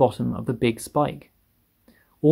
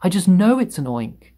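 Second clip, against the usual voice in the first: high, raised frantic cry; screamed insistence; 6 semitones higher, 4 dB louder than usual. A narrator's reading. Fire chief insists on his guess, screaming. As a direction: insist on it in alarm. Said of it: "screamed the head of the fire department".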